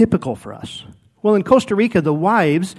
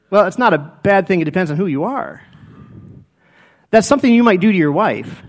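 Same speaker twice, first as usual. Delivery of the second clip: usual speaking voice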